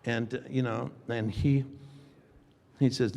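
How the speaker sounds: ordinary delivery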